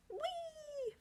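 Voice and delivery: high squeaky wee; teacher squealing wee for kids. Shown as a demonstration. Demonstrating the wee sound.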